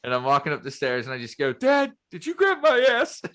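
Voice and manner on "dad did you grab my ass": high, loud put-on catchphrase; spring the movie voice on dad; cheeky impression, pitched way up; club owner impression. Quotes club owner line to dad.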